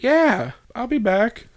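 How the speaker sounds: high-pitched, slow mock female reply; imitated woman. Quoting her answer to the dildo offer, imitated woman.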